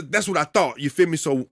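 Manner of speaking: ordinary talk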